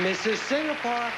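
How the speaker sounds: high ringing pageant announcer's introduction; announcer call. Real, not acted. Host introducing the next contestant to the audience, announcer call.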